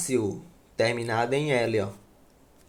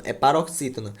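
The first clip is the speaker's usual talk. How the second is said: ordinary talk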